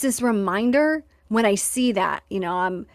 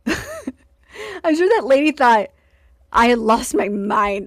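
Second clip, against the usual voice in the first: higher, slower words through a laugh; 3 semitones higher, 4 dB louder, slower than usual. Real, not acted. Remembering the dog owner's stare, laughing at herself. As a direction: laugh at your own craziness.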